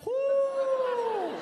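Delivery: high, loud whoop; a long loud whoo of disbelief. Real, not acted. Comedian exclaims about Mexican radio host, loud whoop. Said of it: "put me up against somebody from the motherland".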